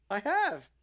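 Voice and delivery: put-on boyfriend's protest. The boyfriend answers his angry girlfriend, high mock squeak. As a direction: play the meek boyfriend pleading. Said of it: "And he goes".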